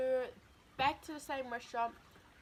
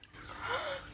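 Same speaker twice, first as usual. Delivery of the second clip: real gasp; 14 semitones higher than usual. Real, not acted. Reacting to food.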